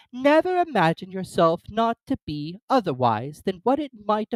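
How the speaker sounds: put-on high, loud fussy old duchess. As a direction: prattle like a silly duchess. Duchess character voice.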